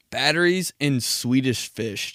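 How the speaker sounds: normal talking voice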